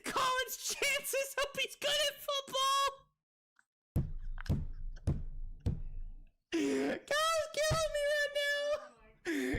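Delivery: very high, slow squeal through laughter; helpless laughter. Real, not acted. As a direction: squeak the words out while cracking up. Cracking up at a joke.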